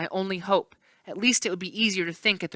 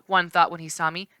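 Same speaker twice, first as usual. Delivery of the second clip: ordinary delivery, nothing marked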